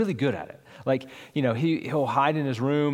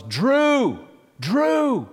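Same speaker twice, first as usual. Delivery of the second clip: much higher, louder desperate yell; panicked yell; 11 semitones higher, 6 dB louder than usual. Retold or imitated. Frantic dad yelling for his missing son outside. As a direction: scream his name into the street. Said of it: "yelling at the top of my lungs".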